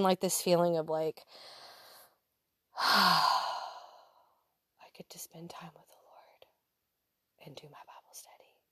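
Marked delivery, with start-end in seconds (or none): 2.7-8.6 whispered, blissful self-talk. Retold or imitated. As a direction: breathe it out in a contented whisper.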